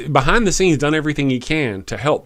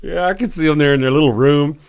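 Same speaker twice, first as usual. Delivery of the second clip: ordinary delivery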